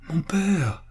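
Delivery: trembling with emotion. A narrator's reading. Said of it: "s'écria Pascal, tremblant d'émotion".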